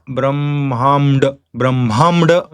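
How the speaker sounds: Brahmamd with m before d; Brahmamd, m instead of n; wrong labial nasal. Shown as a demonstration. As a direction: close the lips before the d in Brahmamd. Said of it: "We don't say Ma there".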